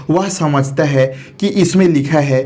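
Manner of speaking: ordinary talk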